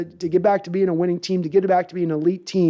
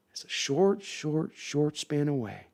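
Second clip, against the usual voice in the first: hushed; 3 semitones lower, 9 dB quieter, slower than usual. Real, not acted. Hushed promise to fans.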